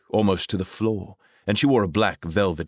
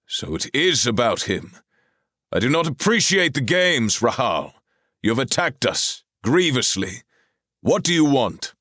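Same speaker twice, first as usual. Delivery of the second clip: higher, louder stern challenge; stern king; 8 semitones higher, 4 dB louder than usual. A narrator's reading. Arthur demands what Rahal wants, stern and raised. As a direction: confront her like an angry king.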